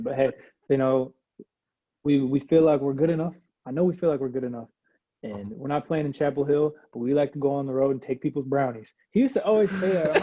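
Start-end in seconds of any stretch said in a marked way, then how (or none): none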